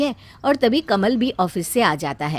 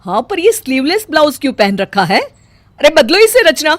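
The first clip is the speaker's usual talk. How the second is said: louder and higher, slowing on the order; angry mother-in-law; 6 semitones higher, 8 dB louder than usual. Acted. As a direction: demand she change, outraged. Mother-in-law objects to blouse.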